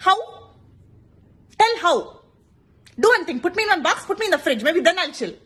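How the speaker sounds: loud, high, exasperated demands; exasperated rant. Real, not acted. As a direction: vent at the adviser. Angry rant at advisor.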